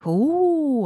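high, loud "oo" howl; wolf howl. A narrator's reading. Forest wolves answer the men's yell, howling. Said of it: "отозвались из леса мои волки".

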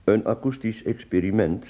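ordinary delivery

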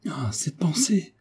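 high, breath-light exclamation of protest; quick protest. A narrator's reading. Herminie protesting the marquis's self-mockery, high hushed exclamation. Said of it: "dit vivement Herminie".

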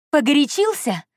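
high, incredulous angry echo; furious. A narrator's reading. Heroine rejects Andrey's excuse, furious. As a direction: throw his word back, pitch leaping up. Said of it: "я не на шутку рассвирепела".

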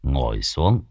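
calm, unhurried. Acted. Old monk calmly tells breathless disciple to sit, slow and low. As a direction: settle him down gently. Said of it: "từ tốn".

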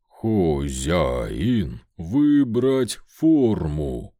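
low, slow, clearer stone rumble; rumbling golem. Acted. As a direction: rumble it out, each word distinct. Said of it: "более чётко пророкотало чудище".

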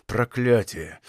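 low, quiet curse through the teeth; low grumbled oath; muttered curse. A narrator's reading. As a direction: curse under the breath. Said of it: "пробурчал капитан сквозь зубы".

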